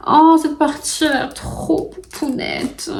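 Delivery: high, slow delighted coo; high-pitched, drawn-out gushing; gushing cuteness. Real, not acted. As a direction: coo over something adorably cute. Gushing over a cute puzzle part.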